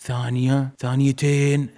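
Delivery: ordinary delivery, nothing marked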